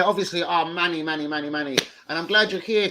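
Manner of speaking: ordinary talk, nothing marked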